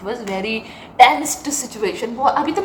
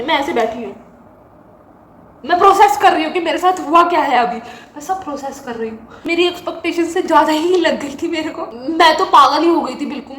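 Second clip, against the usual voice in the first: ordinary talk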